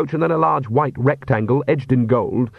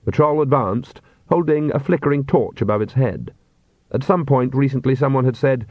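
plain reading voice

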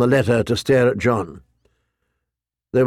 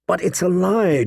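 higher, mildly insistent denial; insistent protest; 6 semitones higher than usual. A narrator's reading. Jon denies the charge to his commander, mildly insistent. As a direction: protest it, understated but firm. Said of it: "John insisted".

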